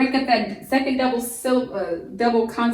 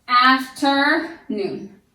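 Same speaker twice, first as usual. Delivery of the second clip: slow, loud "Afternoon", each syllable drawn out; syllables pulled apart; 2 semitones higher, 4 dB louder, much slower than usual. Shown as a demonstration. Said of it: "add a divider between the F and T".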